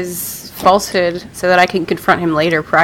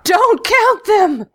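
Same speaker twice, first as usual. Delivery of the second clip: slow and much higher, pleading; drawn-out high plea; 11 semitones higher, slower than usual. Acted. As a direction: stretch it out in desperate pleading.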